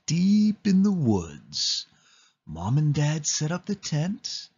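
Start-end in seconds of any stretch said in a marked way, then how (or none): none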